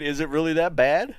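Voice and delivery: ordinary talk, nothing marked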